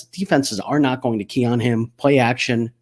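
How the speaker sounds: ordinary delivery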